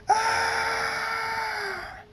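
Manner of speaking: very high, sustained shriek; scream of pain. A narrator's reading. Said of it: "Thunderstorm screams again".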